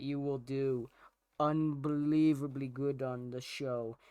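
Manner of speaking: hushed, deliberate mock tribute; mock-solemn well-wishing. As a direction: draw out a grandly sincere good-luck speech. Mock-solemn good-luck speech to prank caller.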